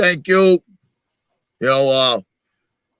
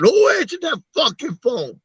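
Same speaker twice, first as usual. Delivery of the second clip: a higher, hammed-up yell of "who answered"; angry demand; 10 semitones higher than usual. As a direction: demand to know who picked up. Gangster bursting in, yelling.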